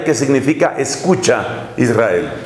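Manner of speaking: ordinary delivery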